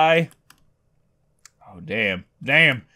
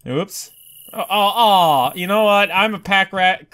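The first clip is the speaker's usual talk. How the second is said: normal talking voice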